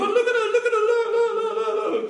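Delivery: very high, loud squealing chant; excited friends. Retold or imitated. Girl's friends watching her shake, imitated excited squeal. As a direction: squeal it over and over, hammed-up. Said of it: "And her friends go".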